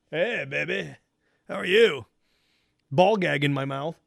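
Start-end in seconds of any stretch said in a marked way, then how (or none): 0.1-2.1 sleazy come-on. Pretend. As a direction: croon it like a creep. Sleazy mock greeting.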